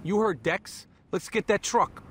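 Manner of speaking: quiet aside. Acted. Crew member to partner heading for the job, quiet aside.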